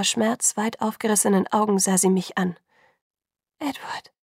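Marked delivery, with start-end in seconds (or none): 3.6-4.1 barely breathed whisper. A narrator's reading. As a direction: whisper the name in shock. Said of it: "flüsterte sie nur".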